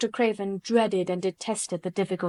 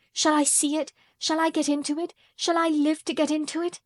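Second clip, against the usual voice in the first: eager pleading; 7 semitones higher than usual. A narrator's reading. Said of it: "he cried out with half a sob".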